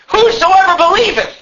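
high-pitched shouted proclamation; shouting. Acted. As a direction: cry the verse out like a great preacher. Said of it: "Jesus have shouted these words".

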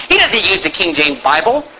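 ordinary talk

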